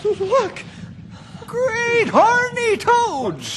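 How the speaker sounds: high, slow, loud amazed cry; stretched-out astonished yell; excited shout. Acted. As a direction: shout it out in wonder at the monster. Sighting the lake monster.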